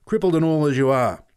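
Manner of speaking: angry rant, loud at the end; angry shouting. A narrator's reading. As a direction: tear into a kid for swearing. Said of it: "he shouted".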